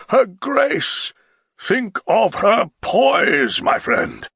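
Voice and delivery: slow, loud scornful shouting; the Baron raving about his Baroness, shouting. A narrator's reading. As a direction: bellow your contempt. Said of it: "he said loudly".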